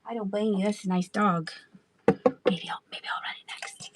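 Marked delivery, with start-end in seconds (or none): 2.1-3.9 muttered aside under her breath; whisper. Real, not acted. Seller musing to herself about the next item, whispered. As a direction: whisper the plan to yourself.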